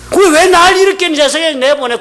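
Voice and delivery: high, loud acted protest; indignant complaint. Preacher plays someone challenging God over being born, indignant protest.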